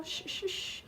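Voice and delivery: whispered shh, barely audible; soft shushing. Pretend. Babysitter hushing softly near the sleeping baby. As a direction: hush them without a sound.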